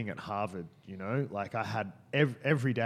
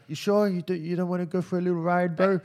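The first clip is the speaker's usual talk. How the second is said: higher-pitched mock Kiwi accent; 8 semitones higher, 7 dB louder than usual. Stranger coaxing her into his car, mock Kiwi accent. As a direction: imitate a pushy Kiwi guy, pitched up and louder. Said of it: "he's got a Kiwi accent".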